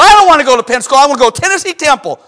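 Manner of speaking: rapid, high, loud imitation of a son refusing; protesting son. Son refuses father's college suggestion, acted as heated protest. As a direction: blurt the objection out in one rush.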